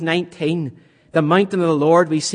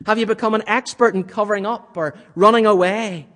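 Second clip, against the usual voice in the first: preaching at full force; 5 semitones higher, 4 dB louder than usual. Real, not acted. Urgent searching questions to congregation.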